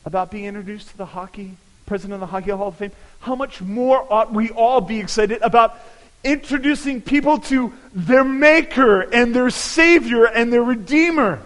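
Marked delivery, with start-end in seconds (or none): 3.2-11.4 rising from strong to loud and higher, preaching full force; building preacher's climax. Acted. Pastor urging congregation toward the savior, building to a loud climax. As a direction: build it up to eternal life.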